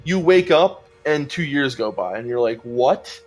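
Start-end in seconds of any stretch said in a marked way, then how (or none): none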